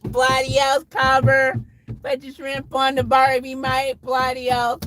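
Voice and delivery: high, hammed-up Aussie accent, bloody hell mate; a bad Aussie impression, played for laughs; Australian accent. Streamer hamming a fake Aussie accent for his chat. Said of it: "attempting to do an Australian accent".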